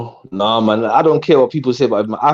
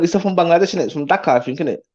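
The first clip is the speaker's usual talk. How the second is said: ordinary talk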